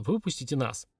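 ordinary delivery, nothing marked

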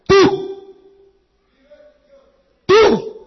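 high, loud accusing wife; wife confronting her drunk husband; bitter reproach. Acted. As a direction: throw the blame back at him. Battered mother reproaches drunk husband.

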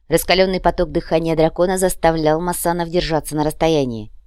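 usual speaking voice

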